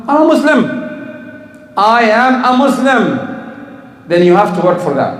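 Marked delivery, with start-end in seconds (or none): 0.1-3.2 1 proud declaration. Pretend. Proud imagined answer.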